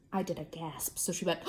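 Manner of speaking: ordinary delivery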